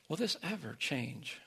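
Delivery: hushed, slow, as if to a spouse; weary quiet question. Acted. Worn-down parent looks at spouse and asks, quietly weary. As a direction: ask it softly, drawn out.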